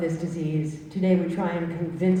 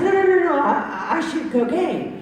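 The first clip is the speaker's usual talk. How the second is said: high, quick protest of no; indignant protest; 8 semitones higher than usual. Retold or imitated. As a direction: insist you're worse than that. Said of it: "And I said".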